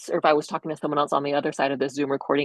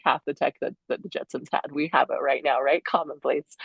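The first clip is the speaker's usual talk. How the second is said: ordinary talk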